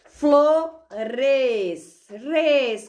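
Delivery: Flores, então res destacado, mais alto; separando sílabas. Shown as a demonstration. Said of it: "Vai aparecer o contrário pra vocês". (professora separando a sílaba res bem marcada)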